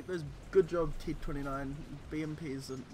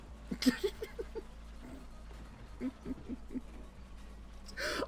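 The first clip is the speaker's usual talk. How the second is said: airy silent laughter; breathless giggling; wheezing laugh; 5 semitones higher, 8 dB quieter than usual. Real, not acted. Keeps laughing at the joke.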